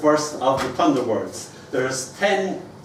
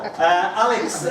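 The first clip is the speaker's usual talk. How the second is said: ordinary talk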